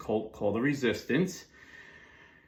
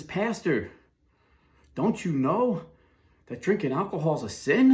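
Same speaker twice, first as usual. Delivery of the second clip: higher, lightly voiced pious neighbor, scolding; scolding Baptist neighbor; 7 semitones higher, 4 dB louder than usual. Neighbor runs over to scold the pastor, mock-shocked. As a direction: play the preachy neighbor calling across the lawn, mock-shocked. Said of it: "So I'm gonna tell you the story the way he said it".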